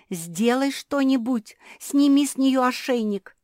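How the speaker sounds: high, loud urgent plea; desperate demand. A narrator's reading. Мафи begs Черчилль to remove the collar, desperately. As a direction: beg loudly for immediate help.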